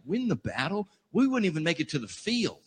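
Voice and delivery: ordinary talk, nothing marked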